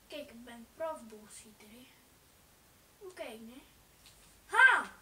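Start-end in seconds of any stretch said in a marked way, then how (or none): 4.4-4.9 sharp startled ha, much louder; sudden ha. Real, not acted. Gamer startled by something on screen, loud ha. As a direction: blurt out a loud surprised ha.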